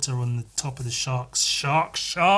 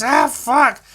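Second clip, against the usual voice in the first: higher, louder yelp of pain; 10 semitones higher, 7 dB louder than usual. Acted. Dinosaur bitten on the tail by the tortoise, a higher, louder yelp. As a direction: yelp like your tail just got bitten.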